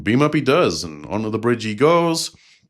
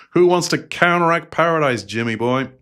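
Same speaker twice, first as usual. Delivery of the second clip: put-on Georgia country doctor accent; 7 semitones higher, 4 dB louder than usual. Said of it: "Georgia country doctor accent".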